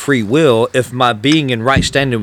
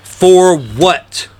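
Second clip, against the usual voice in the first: preaching emphasis; 4 semitones higher, 4 dB louder, much slower than usual. Real, not acted. Preaching climax, loud slow.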